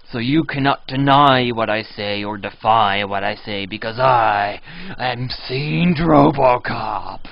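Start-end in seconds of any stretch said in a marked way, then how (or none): 1.5-7.3 lightly put-on hero boast, slow; Robocop voice. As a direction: announce yourself as Robocop. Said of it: "you can't pull that voice up".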